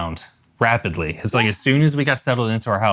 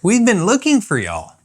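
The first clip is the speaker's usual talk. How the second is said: playful high singsong welcome; singsong greeting; 9 semitones higher than usual. Pretend. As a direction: call out to the viewers like a game host.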